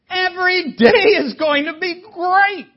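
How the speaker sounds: an emotional burst of joy about heaven; excited. Acted. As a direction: lift it with joyful emotion. Preacher exclaims about heaven.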